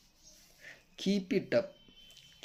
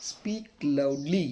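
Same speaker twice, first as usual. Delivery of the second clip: slow model; much slower than usual. Shown as a demonstration. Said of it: "teacher models English phrases".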